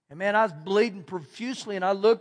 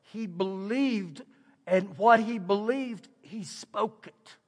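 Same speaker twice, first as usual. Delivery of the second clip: slow emphatic; 3 dB quieter, slower than usual. Real, not acted. Emphatic sermon point.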